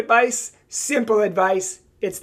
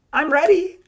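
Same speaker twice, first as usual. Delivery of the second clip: higher, teasing hint to a suitor; playful hint; 7 semitones higher, 3 dB louder than usual. Pretend. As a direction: drop the hint with a wink in the voice. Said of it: "Hint, hint".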